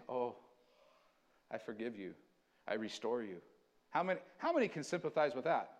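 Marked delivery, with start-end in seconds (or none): 0.1-3.4 tender forgiveness. Acted. God forgiving Israel, voiced.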